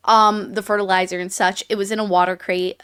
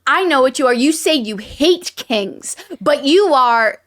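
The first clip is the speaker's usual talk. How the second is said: villain's retort; 4 semitones higher, 5 dB louder than usual. Retold or imitated. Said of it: "and he's like".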